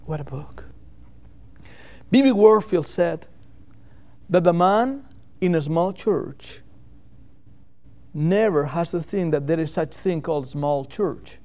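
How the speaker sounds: ordinary delivery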